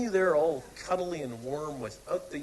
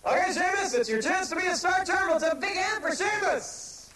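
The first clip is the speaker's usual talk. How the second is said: hyped host; 5 semitones higher, 6 dB louder than usual. Acted. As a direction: sell it like a game-show host. Host introduces star charmer.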